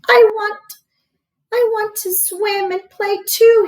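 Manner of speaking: a lonely chick begging to join the swans; longing duckling. Acted.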